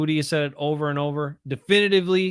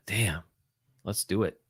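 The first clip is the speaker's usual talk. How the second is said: sighed whisper; 8 semitones lower, 7 dB quieter than usual. Real, not acted. Sighs, then mutters to himself.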